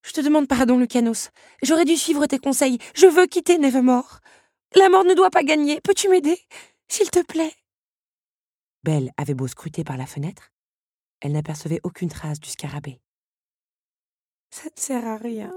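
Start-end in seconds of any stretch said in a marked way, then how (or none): 0.0-7.6 put-on higher, louder pleading young woman; Belle pleading. A narrator's reading. Belle begs Lucanus to help her leave, pleading loudly. 14.5-15.5 despairing. A narrator's reading. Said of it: "se désespéra-t-elle".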